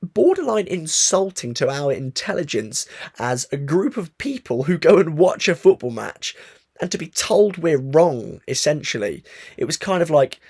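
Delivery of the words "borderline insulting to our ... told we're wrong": indignant, rising and louder; heated rant. Real, not acted. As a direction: let the frustration boil over, slowly. Ranting, frustration building.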